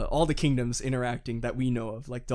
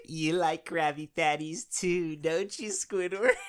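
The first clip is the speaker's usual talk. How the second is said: slow, lightly sing-song Spongebob line; slow Spongebob quote; 2 semitones higher, slower than usual. Retold or imitated. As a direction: tease like Spongebob, drawn out. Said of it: "Tontatas verbatim".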